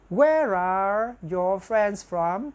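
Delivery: very slow, drawn-out words; slow model sentence. Shown as a demonstration. Teacher models the plural question, very slowly. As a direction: stretch each word for learners.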